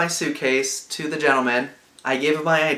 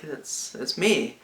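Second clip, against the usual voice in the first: quiet reply; 6 dB quieter, much slower than usual. Retold or imitated. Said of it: "And I was like".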